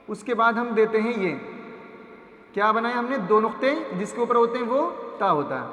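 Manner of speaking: usual speaking voice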